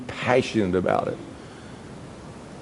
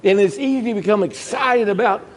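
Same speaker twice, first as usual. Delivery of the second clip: high, rising preacher's appeal; preacher pleading with the congregation to keep their first love, fervent; fervent preaching; 8 semitones higher than usual. Acted. Preacher urges shouting hallelujah.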